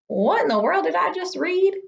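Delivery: high, baffled self-question; puzzled reader. Retold or imitated. Teacher imitates a grown-up confused by reading. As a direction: ask it like you're lost on the page. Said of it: "then we say".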